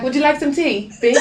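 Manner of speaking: teasing a posh English man; mock posh English. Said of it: "It's probably real proper and shit".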